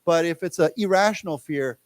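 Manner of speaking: normal talking voice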